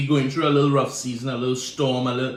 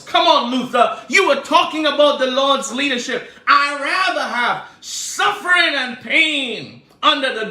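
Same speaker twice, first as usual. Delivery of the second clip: higher, louder preaching cadence; full-force preaching; 11 semitones higher, 8 dB louder than usual. Real, not acted. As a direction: preach it with rising fire.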